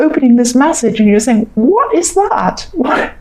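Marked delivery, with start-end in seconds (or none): 1.5-3.1 high, slow bewildered "What is that?"; baffled reaction to a weird text; puzzled. Pretend. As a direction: squint at a strange message and ask. Recipient puzzled reading odd message.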